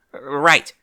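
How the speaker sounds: nervous gulp. A narrator's reading. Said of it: "Rainbow gulped".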